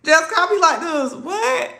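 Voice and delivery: high laughing outburst; high-pitched giggling disbelief. Real, not acted. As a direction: squeal through laughter.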